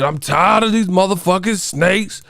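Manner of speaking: impression of an actor raging at snakes; Samuel Jackson impression. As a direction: belt it like Samuel Jackson fed up. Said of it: "you know Samuel Jackson, he said".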